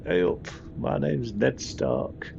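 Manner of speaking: slow British Northern accent impression; drawn-out Northern English, hamming it up; Sean Bean. Player mimicking the Northern-accented station operator, slow Northern impression. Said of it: "It sounds like Sean Bean".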